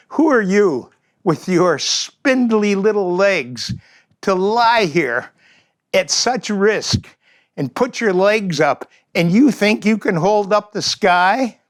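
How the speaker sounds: slow, high scornful taunt; mocking raptor. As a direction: scoff at the tiny bird, slowly. Raptor mocks the bird.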